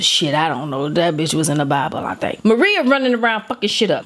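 ordinary delivery

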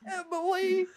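high outraged yell of her name; mock outraged shout. Calling out the question's author.

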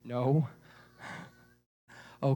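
ordinary delivery

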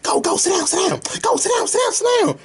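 mock high-pitched holler; yelling bus driver. Bus driver ordering a passenger to sit, mock yell. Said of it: "gonna fucking yell at me".

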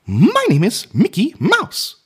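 high falsetto cartoon mouse voice; Mickey Mouse. Shown as a demonstration. Man trying his childhood impression, high cartoon mouse voice. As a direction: introduce yourself in a slow, squeaky falsetto. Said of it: "one of my first impressions was of Mickey Mouse".

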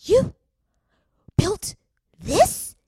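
high, halting podcast-host impression; punchy mock radio-host delivery; Guy Raz. Shown as a demonstration. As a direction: pitch it way up, word by word, with big pauses. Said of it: "I usually do a good impression".

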